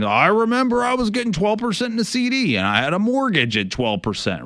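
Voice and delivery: high-pitched mock recollection; imitated reminiscing clients. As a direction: mimic people harking back, pitched well up. Imitates clients recalling old rates.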